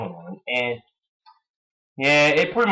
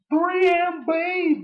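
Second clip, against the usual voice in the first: slow, much higher stretched name; mocking stretched call; drawn-out name; much slower than usual. Real, not acted. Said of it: "or as I like to call him".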